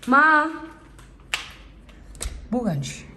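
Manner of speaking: sharp loud call across the house; yelling across the room. Real, not acted. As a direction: shout her name to another room. Calling mother from afar.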